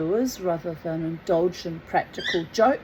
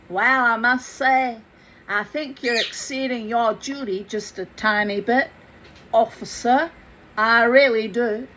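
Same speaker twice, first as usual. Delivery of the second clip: louder, a mix of New York and the South; American accent; 4 dB louder than usual. Shown as a demonstration. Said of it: "sounds like a cross between New York and the South".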